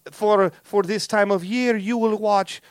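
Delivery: slow mock-Brazilian accent; Latin Brazilian woman. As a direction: drag it out in a fake Brazilian accent. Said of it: "smoking hot Latin Brazilian chick".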